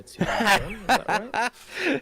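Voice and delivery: slowed, laughing through the question. Real, not acted. Game master laughing while asking the player, real laughter. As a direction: chuckle while checking the name.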